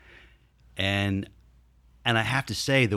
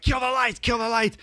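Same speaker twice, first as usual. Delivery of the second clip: urgent shout; 13 semitones higher than usual. Retold or imitated. Staff yelling during chaos.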